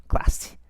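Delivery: "Classe." whispered, stressing the single S sound; whispered word demo. Shown as a demonstration. Teacher whispers "Classe." to pupils, hissing the S. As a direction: breathe "Classe." voicelessly to show the hissed S. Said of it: "que é S, certo?".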